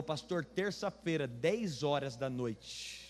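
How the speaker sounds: drawn-out words, lingering on the late hour; slow, dragged-out mock-eerie delivery; ominous invitation. Church member naming a late-night visit, slow and ominous. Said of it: "já foi ficando macabro".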